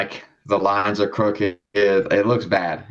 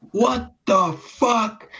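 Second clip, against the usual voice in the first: very high, drawn-out mock outburst; imitated outraged dad; 14 semitones higher, much slower than usual. Son retells dad hopping in the smelly car, imitated outrage.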